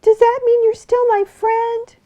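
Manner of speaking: high, squeaky little fox pleading; small fox begging the bear to play, high and squeaky. Acted. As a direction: whine to be allowed to play. Tearful fox voice.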